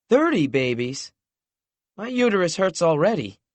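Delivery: playful mock dismay; lighter note. A narrator's reading. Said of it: "a lighter note in her voice".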